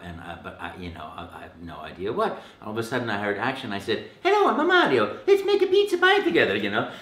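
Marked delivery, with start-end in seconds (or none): 4.2-6.6 very high, bright Italian cartoon plumber; squeaky playful Italian cartoon hero; high, loud, playful Italian plumber voice; Mario voice. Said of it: "having so much fun with the voice".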